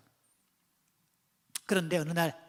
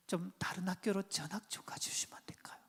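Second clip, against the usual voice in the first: hushed plea; 10 dB quieter than usual. Retold or imitated. Teacher's embarrassed request to mother.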